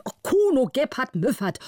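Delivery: high indignant outburst, settling on the name; angry call. A narrator's reading. Count calling out to Müffert, angry. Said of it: "war zornig und rief".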